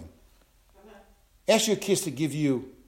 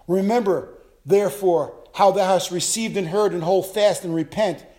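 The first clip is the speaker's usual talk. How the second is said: louder, fervent scripture reading; 5 semitones higher, 6 dB louder than usual. Acted. Pastor reading Revelation to the congregation, fervent and loud. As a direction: proclaim the verse to the church.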